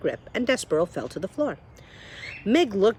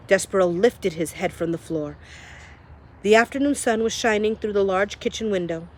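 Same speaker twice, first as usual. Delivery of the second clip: ordinary delivery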